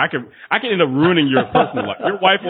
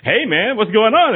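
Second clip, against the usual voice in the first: cheery greeting; 8 semitones higher, slower than usual. Retold or imitated. Overly happy coworker greets him in the morning, cheery high hello. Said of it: "the coworkers all like".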